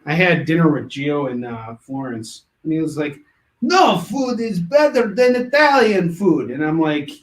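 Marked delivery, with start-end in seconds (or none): 3.6-6.5 loud, high, slow mock-Italian; horrible Italian accent. Artist boasts over dinner in Florence, bad Italian accent. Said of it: "sorry for the horrible Italian accent".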